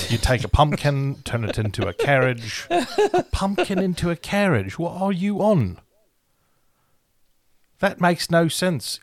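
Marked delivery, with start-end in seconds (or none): 2.7-9.0 mock incredulous. Mocking the fairy godmother's logic.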